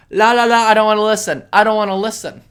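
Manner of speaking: mock people refusing to listen, childish and loud. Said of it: "you know what the people like to do?".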